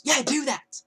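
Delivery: high, loud, curt mock command; bitchy order. Girl ordering the kiosk guy to take it out, bitchy. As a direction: imitate her bossing him around. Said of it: "decided to say it like that".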